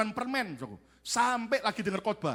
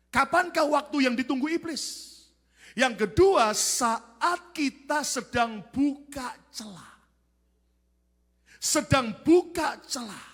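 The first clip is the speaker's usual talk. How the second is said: loud, high, stretched-out proclamation; full-force preaching; 7 semitones higher, 6 dB louder, slower than usual. Real, not acted. Preacher teaching when the devil strikes, full-force. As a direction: proclaim the point with long, heavy words.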